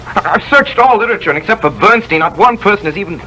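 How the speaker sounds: put-on young scientist crushed to learn someone beat him to it; shocked protest. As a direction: fight the news, voice climbing in dismay. Discovery pre-empted, desperate protest.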